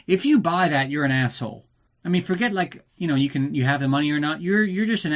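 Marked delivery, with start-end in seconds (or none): none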